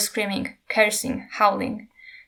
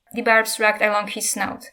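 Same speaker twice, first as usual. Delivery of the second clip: ordinary delivery, nothing marked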